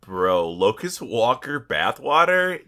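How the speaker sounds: ordinary talk, nothing marked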